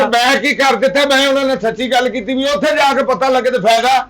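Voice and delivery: high-pitched, aggrieved self-defence; indignant protest. Acted. Protesting when blamed in quarrel.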